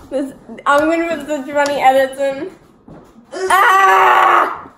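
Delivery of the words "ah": loud, high pained yell. Real, not acted. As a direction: yell out sharply. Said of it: "Stop yelling!".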